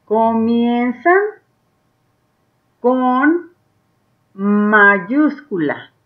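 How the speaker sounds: very slow, word-by-word read-aloud; slow dictation-style reading for beginners; reading along with learners. Shown as a demonstration. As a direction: read one word at a time, pausing long between words. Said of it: "lee junto conmigo".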